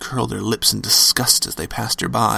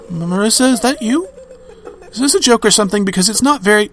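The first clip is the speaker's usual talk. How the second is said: nervous; 11 semitones higher, 4 dB louder, faster than usual. Acted. Nervous call into phone.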